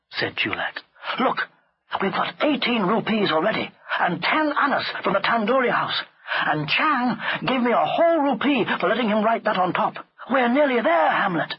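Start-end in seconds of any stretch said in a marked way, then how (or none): 1.2-11.5 put-on high, eager boy's voice; higher-pitched, upbeat young schemer; eager servant boy. A narrator's reading. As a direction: talk the elephant round. Chulak encourages Hamlet.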